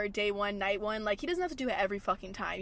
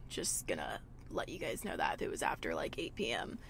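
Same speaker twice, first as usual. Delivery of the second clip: much lower, softer secret whisper; whispered aside; 8 dB quieter than usual. Real, not acted. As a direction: lean in and whisper it.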